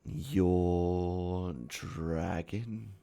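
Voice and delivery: extremely drawn-out villain words; Hat Man villain. Acted. Hat Man questioning the player's plan, slow villain voice. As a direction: drag out each word menacingly.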